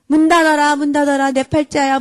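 mock mum venting her frustration; exasperated complaint. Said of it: "말하니까 보상을 다 받은 거예요".

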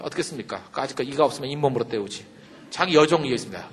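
ordinary delivery

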